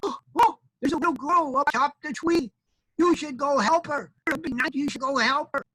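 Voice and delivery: high-pitched little chick voice; squeaky, insistent hatchling; baby chick, urgent. Acted. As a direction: plead in a tiny, high bird voice.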